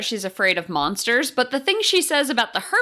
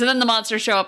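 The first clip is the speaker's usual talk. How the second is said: ordinary delivery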